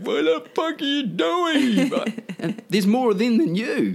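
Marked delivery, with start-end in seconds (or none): 0.0-1.9 high, slow mock old man; grumpy old man mock outrage; grumpy old guy. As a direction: play the bewildered old guy on the sidelines. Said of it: "You're that old guy going".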